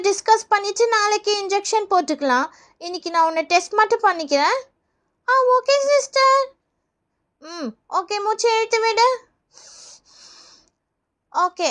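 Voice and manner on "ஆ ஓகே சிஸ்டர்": high, slow schoolkid voice; drawn-out little-kid reply; child pupil. Acted. Pupil telling nurse it doesn't hurt, slow child voice.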